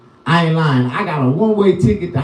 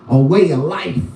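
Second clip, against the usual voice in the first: ordinary talk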